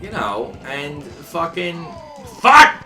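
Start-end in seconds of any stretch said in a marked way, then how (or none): none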